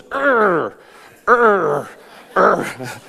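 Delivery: three falling grunts, starting high and stepping down; imitated child's pained grunts. Father imitating his little son pretending to hit his thumb, mock pained grunts. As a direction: mimic a little boy copying dad's grunts. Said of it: "he kinda goes like this".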